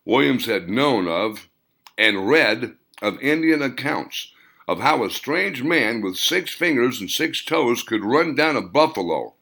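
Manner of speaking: plain reading voice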